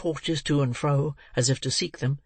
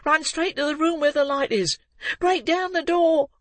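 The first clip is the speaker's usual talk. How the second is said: put-on urgent shouting boy. A narrator's reading. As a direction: shout them to the right room. Boy urging crowd to break in.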